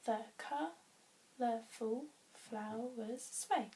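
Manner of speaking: "The colourful flowers sway" in separate, measured beats; counting syllables. Shown as a demonstration. Teacher counting to seven, each syllable apart.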